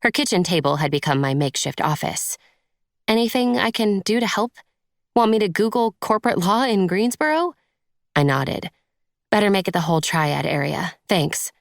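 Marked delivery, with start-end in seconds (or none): 3.1-7.5 higher, eager helper's voice; friend offering help. A narrator's reading. Friend offering to search jobs, eager and higher. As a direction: offer to help, keen and light.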